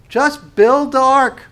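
high, loud, slow command; God's command. Retold or imitated. God telling Noah to build, slow and loud.

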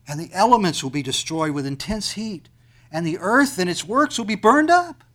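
loud, soaring pulpit delivery with stretched climaxes; full-force preaching. Acted. Preacher to congregation about the last day, soaring loud preaching. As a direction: thunder it out to the whole room.

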